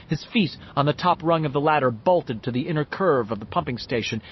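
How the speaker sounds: usual speaking voice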